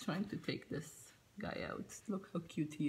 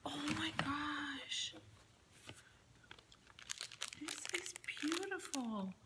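higher, hushed whisper of awe; awed whisper; 7 semitones higher, 5 dB quieter than usual. Real, not acted. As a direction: whisper it like it's precious. Awed by gift.

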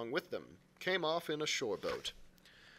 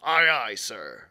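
sailor acknowledging; 4 semitones lower, 9 dB louder, slower than usual. Acted. Cook answers the captain.

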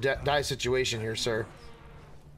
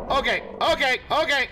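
normal talking voice

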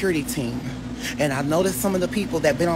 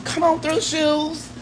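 hyping the shoes; 9 semitones higher, much slower than usual. Acted. Cheering on the shoes.

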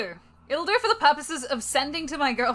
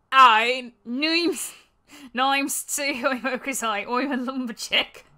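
broad put-on Australian, drifting towards Irish; Australian accent; 2 semitones higher, 5 dB louder, much slower than usual. Lumberjack introduces himself, hammed-up Australian accent. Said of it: "there is an Australian accent generator".

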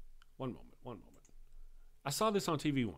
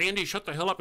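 raised, louder mock irritation; playful retold outburst; mock annoyed retort; 6 semitones higher, 5 dB louder, faster than usual. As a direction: snap back at a friend in jest. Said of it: "And I'm like".

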